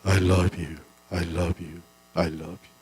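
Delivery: the hills answering back, fading; fading echo. Acted. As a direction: let it fade like it comes back from far hills. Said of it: "여기저기서 소리가 들려옵니다".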